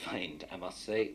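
ordinary talk, nothing marked

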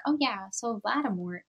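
soft, low, offhand mock remark; casual. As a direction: imitate someone casually chatting. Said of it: "was just talking normally".

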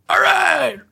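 hyped shout; excited whoop. Retold or imitated. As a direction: yell it like the party's starting. Friends arrive at the hotel.

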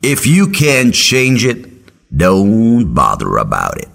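slow, lightly voiced mentor advice; wise old mentor. Professor slowly advising his student on politics. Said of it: "He said".

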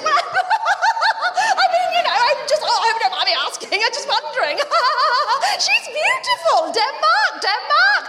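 high, babbling mock panic with nervous laughter; flustered existential crisis. Friend backtracking after asking about the sperm, flustered crisis. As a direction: act out a friend squeaking through a meltdown. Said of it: "an existential crisis of some sort".

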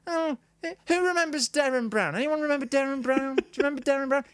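high-pitched parody of a stand-up; mock an amateur working the crowd; observational comic. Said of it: "trying to do observational comedy".

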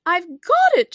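high, loud exclamation; triumphant cry. A narrator's reading. The Queen catches her flying shawl, crying out. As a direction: call it out in triumph. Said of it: "she cried".

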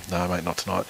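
put-on soft, hushed reply to a sleepy kid; hushed bedtime reply. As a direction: keep it low, he's nearly asleep. Dad answering son in bed.

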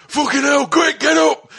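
a rushed bad Welsh wake-up call; bad Welsh accent. Welsh club owner waking the hungover band, bad Welsh accent. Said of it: "I won't do his Welsh accent very well".

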